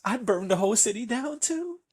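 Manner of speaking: high, wailing protest; mock-anguished parent over a child; distraught parent. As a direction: plead it high, hammed-up. Voicing parent of kidnapped child.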